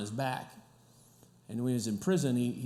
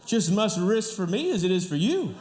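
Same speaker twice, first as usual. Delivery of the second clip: louder, higher teasing with laughs breaking through; joking mock threat; 8 semitones higher, 4 dB louder than usual. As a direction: play at menace while cracking up. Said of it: "No, I'm just kidding".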